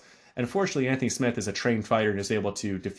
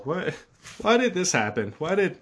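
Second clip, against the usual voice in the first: baffled outburst; 8 semitones higher than usual. Real, not acted. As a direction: blurt it out in real confusion. Handed a Bible unexpectedly.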